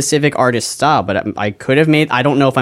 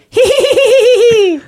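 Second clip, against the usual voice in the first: shrill high villain tee-hee; high evil giggle; 5 dB louder, slower than usual. Shown as a demonstration. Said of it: "My best evil laugh is more like".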